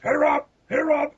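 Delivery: high-pitched imitation of a holler; mock calling out. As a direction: call out in a goofy high voice. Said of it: "the just standing gags of the con".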